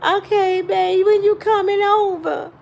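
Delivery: high-pitched mock tearful woman; put-on weepy, needy girlfriend; imitated crying. Girlfriend crying on the phone asks him over, mock weepy. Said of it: "She on the other end crying with him".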